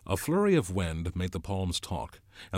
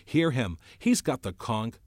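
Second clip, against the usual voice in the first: loud, raised-pitch call for order; forceful acted shout; 5 semitones higher, 7 dB louder than usual. A narrator's reading. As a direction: demand that the crowd listen. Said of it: "Ralph shouted".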